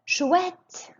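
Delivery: teacher models delight to the class. Shown as a demonstration. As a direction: squeal "Chouette" happily up. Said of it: "Écoutez et répétez".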